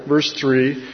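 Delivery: ordinary talk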